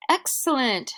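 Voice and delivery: high, loud cheer; shouting. Acted. Travelers cheering the child with a carrot, loud and high. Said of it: "shouted the travelers".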